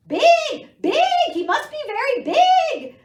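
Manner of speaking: very high, loud flustered duck; sputtering duck. Acted. Duck panicking about Storm, very high loud sputter. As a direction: squawk it in a panicked flurry. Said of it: "Duck sputtered".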